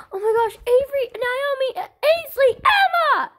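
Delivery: high startled yell; alarmed shout. Pretend. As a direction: call out the kids in alarm. Mother sees injured kids.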